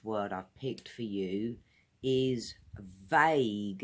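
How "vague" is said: vague with a crisp V at the start; clear V sound. Shown as a demonstration. Teacher showing learners the V in vague, crisp. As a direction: lean on the V of vague. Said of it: "It's like a V sound at the beginning".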